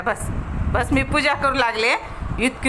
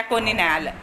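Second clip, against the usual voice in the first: soothing; 6 semitones lower, 4 dB quieter than usual. Real, not acted. Owner reassures her dog that nobody came in, lower and soft. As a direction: lower and softer, gentle reassurance. Calming dog after bad dream.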